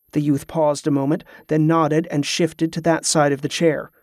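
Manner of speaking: ordinary delivery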